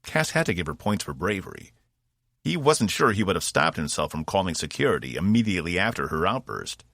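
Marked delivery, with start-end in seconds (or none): none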